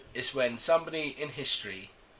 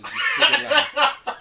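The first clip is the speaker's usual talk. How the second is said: laughing insult; 11 semitones higher, 7 dB louder, much slower than usual. Acted. Mock insult mid argument.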